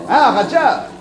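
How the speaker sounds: high, loud, proud cry of the name; proud call. Acted. Showing his favourite tiger to his friend, proud and excited.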